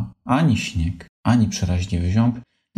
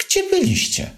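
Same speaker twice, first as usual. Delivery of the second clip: mother voice. Acted. Mother questions soaked son.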